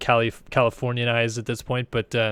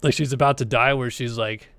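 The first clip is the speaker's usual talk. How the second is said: ordinary talk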